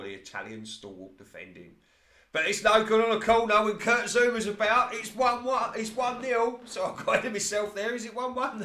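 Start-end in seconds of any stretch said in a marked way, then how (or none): 2.3-8.6 much higher and louder, real excitement; excited goal call. Real, not acted. Commentator calling the underdogs' first goal, excited. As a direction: call the goal like the crowd just erupted.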